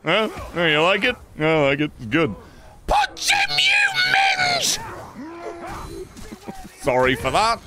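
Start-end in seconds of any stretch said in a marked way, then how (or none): 2.9-4.8 frantic yell. Real, not acted. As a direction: scream it at the screen. Frantic yell at game fight.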